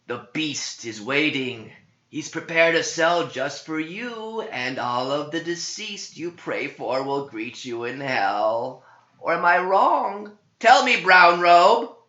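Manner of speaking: high, loud mocking demon voice, climbing; taunting demon. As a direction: gloat over the priest like a demon.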